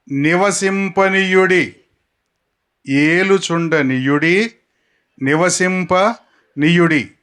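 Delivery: each long word drawn out slowly; slow drilled words. Shown as a demonstration. Preacher drawing out each verse word for repeating. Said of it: "మీరు గుర్తుపెట్టుకోవాల్సిన మాట".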